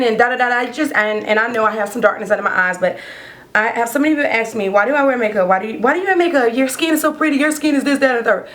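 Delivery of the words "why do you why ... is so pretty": usual speaking voice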